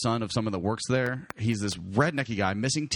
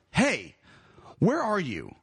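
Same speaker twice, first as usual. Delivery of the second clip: higher, pointed retold irritation; livid; 6 semitones higher, much slower than usual. Teller phoning his friend, irritated demand. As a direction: demand it with contained anger. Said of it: "I was livid".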